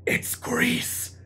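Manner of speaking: hushed theater whisper. Shown as a demonstration. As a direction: whisper it to your neighbor. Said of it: "do we whisper".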